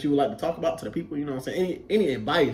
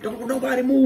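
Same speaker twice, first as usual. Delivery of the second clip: drill instructor; 8 semitones higher than usual. Retold or imitated. Drill instructor orders recruits standing on the line, commanding. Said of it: "our drill instructors had to stand".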